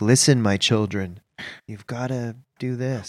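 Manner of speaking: low, slow solemn oracle voice; wise Oracle. Retold or imitated. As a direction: speak like a cloaked hologram oracle. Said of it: "the character who's like the Oracle".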